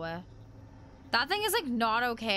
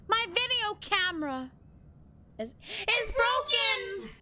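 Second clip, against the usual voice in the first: higher-pitched cry of dismay; dismayed outcry; 8 semitones higher than usual. Real, not acted. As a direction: exclaim it in real dismay. Dismayed at broken camera.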